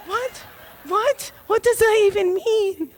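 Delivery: baffled. Retold or imitated. Police baffled reaction.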